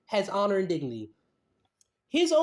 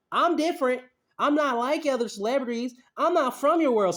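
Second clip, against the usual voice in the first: mock-proud Jay voice, raised pitch; mocking Jay, higher; 4 semitones higher than usual. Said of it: "what he's saying is".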